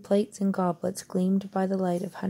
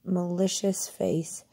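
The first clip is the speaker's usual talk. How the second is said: ordinary delivery, nothing marked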